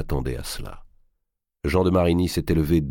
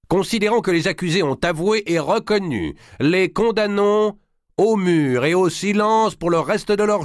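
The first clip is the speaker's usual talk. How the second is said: voix haute et lente de légat qui lit la sentence; déclamation solennelle; 13 semitones higher, 4 dB louder than usual. A narrator's reading. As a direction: proclamer le verdict avec emphase, très haut. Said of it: "dans sa déclamation". (le légat lit la condamnation devant la foule, voix haute et solennelle)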